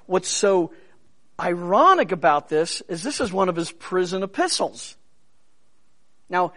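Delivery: ordinary delivery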